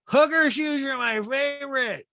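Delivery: very high, slowed mocking impression; Rinna. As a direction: mimic a friend squealing her approval. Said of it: "Rinna goes".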